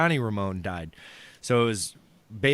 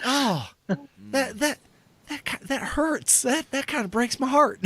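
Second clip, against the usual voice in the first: mock heartbroken folk singer; hurt [name]; 12 semitones higher than usual.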